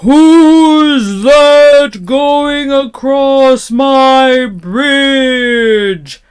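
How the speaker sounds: loud, drawn-out troll bellow; great terrible shout. A narrator's reading. Troll shouting at the middle-sized goat, terrible roar. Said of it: "shouting at him in his great, terrible voice".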